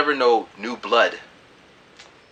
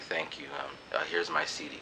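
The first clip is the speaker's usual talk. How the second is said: much softer and lower; shy thanks; 7 semitones lower, 15 dB quieter than usual. Retold or imitated. Handing the girl his CD, shy and soft. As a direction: mumble it bashfully. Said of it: "I'm like".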